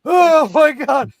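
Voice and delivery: high, loud pained cries; acted agony. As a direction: groan and wail as if crushed. Acting out pain of the impact.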